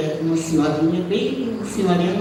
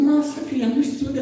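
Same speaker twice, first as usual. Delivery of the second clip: higher, delighted exclamation; amazed father; 8 semitones higher than usual. Retold or imitated. Father marvels at the chicken dinner, pitched higher in delight. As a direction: marvel at the food like her father.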